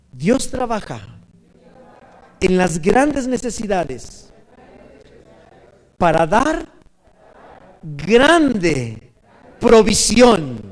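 feed it out slowly, ending big; leading call-and-response. Real, not acted. Preacher has the congregation repeat after him, slow and emphatic.

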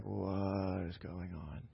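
low, slow, stunned near-whisper; slow low baffled murmur; hushed bewildered question. Retold or imitated. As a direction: say it in disbelief, barely audible. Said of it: "He's like".

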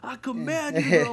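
high, loud bodybuilder command; C T Fletcher shouting. Retold or imitated. Guy imitating the bodybuilder from his videos, loud shouted command. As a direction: bellow it like a hyped-up gym preacher. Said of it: "Have you seen C T Fletcher's vid".

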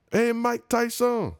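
high, slow hawker call of the name; hammed-up high shout of the greeting; mock hawker call. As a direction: call it out like an eager street seller. Street hawkers calling out to him.

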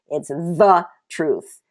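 slow, drawn-out "the" before "truth"; "the" held long for emphasis; stress on THE. Real, not acted. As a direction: lean hard on "the" as the only one. Preacher insists on the one truth.

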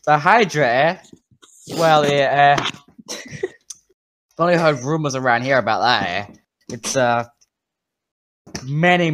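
Canadian barkeep passing on rumors; Canadian accent. Acted. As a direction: tell the tale in a put-on Canadian accent. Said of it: "Why is he Canadian?".

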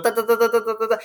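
rapid-fire high "ta" syllables; high staccato splashing imitation; imitated kicking noise. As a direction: patter out the sound of kicking feet. Imitates children kicking in water.

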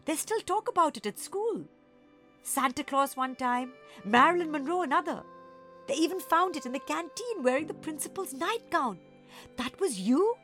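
high, awestruck excitement; amazed gasp. A narrator's reading. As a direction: gush in disbelief at a legend. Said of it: "Monkey gasped".

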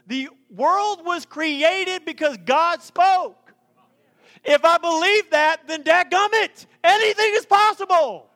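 high, loud, slow build to a shout; preaching at full force. Real, not acted. Pastor driving faith home to congregation, loud and high. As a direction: hammer it home like a revival preacher.

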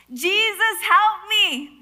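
high, loud cry for help; acted urgent plea; pleading. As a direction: call out a desperate prayer.

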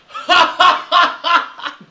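high, loud cackling laugh; shrill mic laugh. Retold or imitated. Daniel cackling into the microphone, high loud laugh. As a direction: imitate a wild laugh blaring into the mic. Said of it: "like that, through the mi-, into the microphone".

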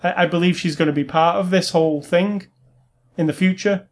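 ordinary talk

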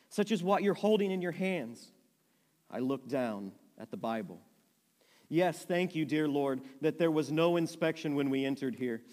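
lively read-aloud of a memoir; steady storyteller reading to a group; story narration. A narrator's reading. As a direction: read the story with feeling, voicing each sister.

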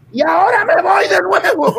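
high laughing punchline. Acted. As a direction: crack up on the punchline.

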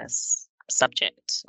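usual speaking voice

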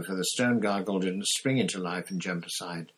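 plain reading voice

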